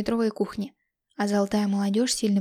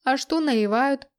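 joking; 4 semitones higher, 5 dB louder, slower than usual. Acted. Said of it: "балагурил Тимка".

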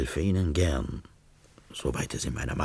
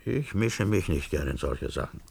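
ordinary talk, nothing marked

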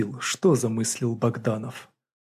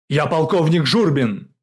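loud, higher military bark; 5 semitones higher, 9 dB louder than usual. A narrator's reading. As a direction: bark out your rank and name. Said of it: "рявкнул Журбин". (рявкнуть)

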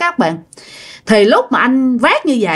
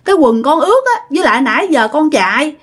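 higher-pitched, earnest self-defence; pleading explanation; 6 semitones higher than usual. Retold or imitated. Accused Quang explaining his wet trousers slipped, high acted plea. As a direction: act out a young man begging not to be misunderstood. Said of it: "anh Quang mới phân trần".

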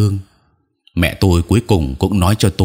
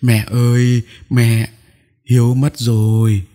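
higher, tearful wail to mother; high-pitched, grief-stricken cry; sobbing; 6 semitones higher, slower than usual. A narrator's reading. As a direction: break the death to your mother in tears. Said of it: "khóc nức nở".

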